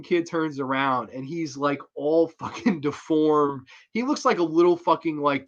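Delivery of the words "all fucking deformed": ordinary talk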